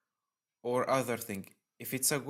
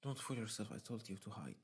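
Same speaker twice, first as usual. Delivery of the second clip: menacing hush; 4 semitones lower, 7 dB quieter, faster than usual. Acted. Game's menacing line read aloud.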